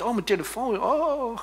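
high mock groan of worry; exaggerated phone panic; mock fretting. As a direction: moan it like someone fretting. Mimics fretting over a phone.

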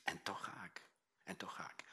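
a low, hushed vow, breathed out; quietly resolved whisper, almost to himself; whispered resolve. Acted. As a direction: whisper it, soft and determined. Jesus's resolve repeated.

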